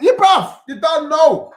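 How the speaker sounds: high, loud burst of hype; hyped shout. Real, not acted. Streamer answering his chat with a loud, high hyped shout. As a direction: jump in loud and pumped.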